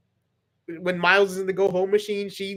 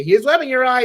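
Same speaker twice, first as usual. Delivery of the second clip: hero quip voice; 7 semitones higher, 6 dB louder than usual. Pretend. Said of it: "Spider-Man would say".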